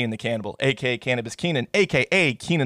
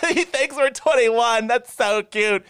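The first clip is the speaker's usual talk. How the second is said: much higher, slow mock girl voice with a laugh; giggly party girl; 9 semitones higher, much slower than usual. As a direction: coo it like a flattered birthday girl. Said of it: "She goes".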